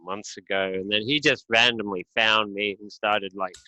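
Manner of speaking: ordinary delivery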